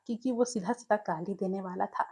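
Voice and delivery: usual speaking voice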